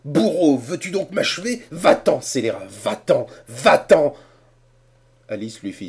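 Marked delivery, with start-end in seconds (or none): 0.0-4.2 loud, high angry shouting; furious dismissal. A narrator's reading. Cornelius drives Harrison from the room. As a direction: chase him out with shouts.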